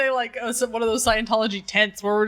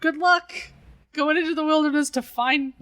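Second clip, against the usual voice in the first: higher, mock-polite well-wishing; see him off with hesitant, pitched-up encouragement; awkward send-off; 7 semitones higher, slower than usual. Team bids dinosaur man farewell, mock-encouraging and higher.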